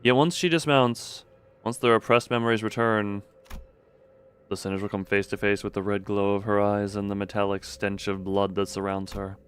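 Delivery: story narration. A narrator's reading. Reads game narration.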